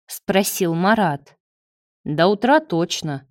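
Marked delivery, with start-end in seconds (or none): none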